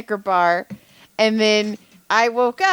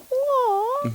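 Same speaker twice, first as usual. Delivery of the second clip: high, drawn-out "aw"; mock hurt. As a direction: whine it high, pretending to be wounded. Mock hurt reply to listener's criticism.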